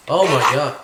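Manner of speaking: loud burst of alarm; sudden loud shock; startled cry. Real, not acted. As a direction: yelp it out, caught off guard. Startled by something in game.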